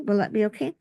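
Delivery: ordinary talk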